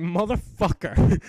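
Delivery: groaning at yet another invader; drawn-out curse. Retold or imitated. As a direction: stretch the swear out in fury. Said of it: "and I'm like".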